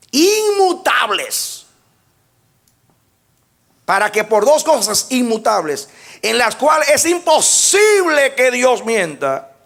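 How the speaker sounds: high, loud, slow preaching of the verse; full-force preaching. Acted. Preacher proclaiming the verse to the congregation, full force. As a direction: proclaim the oath to the church.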